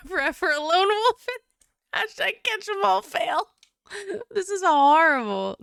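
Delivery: high, loud laughing. Real, not acted. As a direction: laugh through the words. Laughing reading the message.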